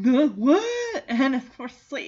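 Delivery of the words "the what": a loud, baffled yelp, higher; mock-dim outburst of confusion; played-up clueless surprise; dumb surprise. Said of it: "he's like".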